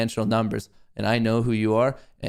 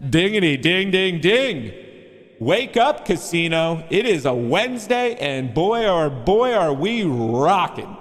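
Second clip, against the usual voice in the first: high, loud hype announcer opening the casino; 6 semitones higher, 4 dB louder, slower than usual. Acted. Streamer opening the market show, hyped announcer voice. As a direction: ring the bell like a game show host. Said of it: "I'm still on this announcement voice".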